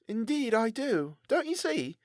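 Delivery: lightly voiced glum apprentice; Tobias voice.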